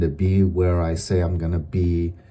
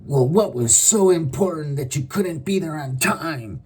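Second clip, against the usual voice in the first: much higher put-on scolding voice; irate accuser; 7 semitones higher than usual. The angry person scolds the latecomer, much higher. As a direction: lecture like a peeved boss.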